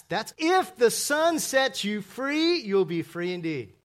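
high, loud and slow, every word hammered; preaching climax. Real, not acted. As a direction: lift it up and proclaim. Preacher's climactic punch line.

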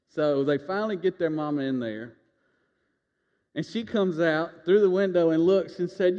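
ordinary talk, nothing marked

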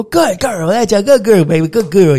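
high, loud puppy praise; sing-song good girl praise; praising a puppy. Shown as a demonstration. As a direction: gush praise at the dog. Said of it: "You go".

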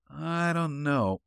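unsure, drawn-out reply; hesitant. A narrator's reading. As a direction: drag it out doubtfully and quietly. Henley hesitates over the invitation.

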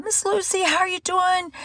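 reenacted chirpy check-in call; friendly phone greeting. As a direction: greet her sweetly up high.

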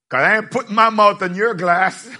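normal talking voice

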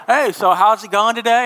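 high, loud, breezy small talk; a cheery dental hygienist, hammed up; mock hygienist chat. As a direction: play the chirpy hygienist making chitchat. Hygienist chats while scraping teeth.

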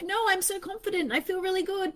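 mock confidence. Anxiety pretending all is fine, mock confident. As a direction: breeze through it unconvinced. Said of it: "It's like".

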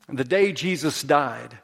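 ordinary talk, nothing marked

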